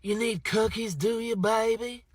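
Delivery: high, loud and slow, doting on the customer; sweet Southern server. Retold or imitated. Chick-fil-A lady handing over cookies, high, slow and doting. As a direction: coo it like a motherly cashier calling him baby. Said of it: "she went".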